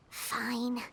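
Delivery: a grudging whispered fine; whispered aside. Acted. Paimon aside to the traveler, grudging whisper. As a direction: breathe it out under your breath, annoyed.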